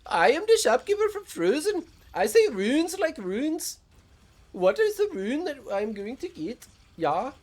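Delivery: very high, loud cartoon Scandinavian accent; Frozen shopkeeper accent. Streamer mocks viewers' pronunciation, hammed-up Scandinavian shopkeeper accent. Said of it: "wants me to pronounce it like this".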